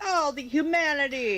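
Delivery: high, overdone mock lament; hammed-up tragic wail, pitched high; dramatic lament. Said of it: "but you're like".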